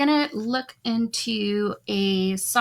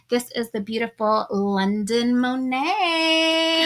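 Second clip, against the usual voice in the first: hype host introduction; 4 semitones higher, 5 dB louder, slower than usual. Real, not acted. Introducing the first live guest.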